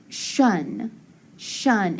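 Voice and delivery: "shun" said twice, with an SH like in shut; teacher giving the TION sound to the class. Shown as a demonstration. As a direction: say the ending "shun", not a T sound. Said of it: "that says".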